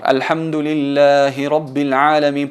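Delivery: slow, drawn-out Arabic recitation through to Alamin; sustained verse reading, no break after Alhamdulillahi; recited without stopping. Shown as a demonstration. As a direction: carry "Alhamdulillahi Rabbil Alamin" on in one breath, long vowels. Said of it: "tenemos que volver a retomar".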